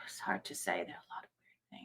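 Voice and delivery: breathy whisper, musing aloud. Real, not acted. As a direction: whisper it to yourself, thinking. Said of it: "thinks aloud, sighs".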